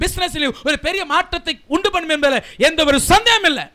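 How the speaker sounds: loud, driving sermon delivery; impassioned preacher at full volume; full-force preaching. Real, not acted. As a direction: proclaim the promise to the whole congregation, loudly.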